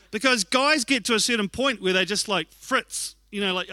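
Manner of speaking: normal talking voice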